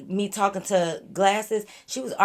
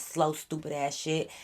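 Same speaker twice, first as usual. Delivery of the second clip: ordinary talk, nothing marked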